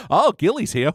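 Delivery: high teasing sing-song; taunting Night's Watch. Retold or imitated. Night's Watch teasing Sam about Gilly, high taunt. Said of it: "they just go".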